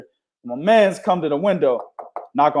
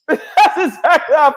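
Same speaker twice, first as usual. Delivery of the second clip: repeated through laughter, higher; laughing; 11 semitones higher, 3 dB louder, slower than usual. Real, not acted. Host repeating his point to the cohost, laughing. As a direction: say it again while laughing. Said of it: "That's exactly how I feel right now".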